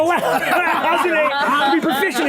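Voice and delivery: high, hammed-up online complaining; high-pitched mock indignation with laughter; mock outraged commenters. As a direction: imitate whining commenters, breaking into laughter. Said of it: "seeing a thousand comments right now going".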